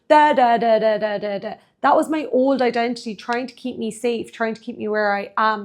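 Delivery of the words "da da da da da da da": dismissive, sing-song da da da, first burst louder; mocking da da da. Coach brushing off her ego's chatter, mocking da da da. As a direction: brush the ego off with a careless da da da.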